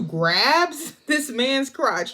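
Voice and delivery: ordinary talk, nothing marked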